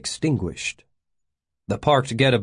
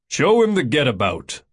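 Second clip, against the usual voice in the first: raised-voice command, pitched up; higher, forceful instruction; barked order; 5 semitones higher than usual. A narrator's reading. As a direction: order a subordinate firmly. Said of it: "he ordered Gates".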